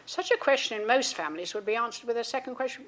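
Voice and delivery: ordinary talk, nothing marked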